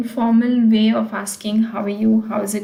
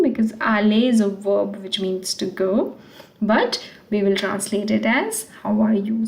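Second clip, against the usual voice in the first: normal talking voice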